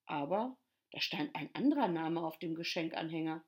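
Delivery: usual speaking voice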